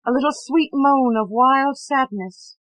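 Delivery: ordinary delivery, nothing marked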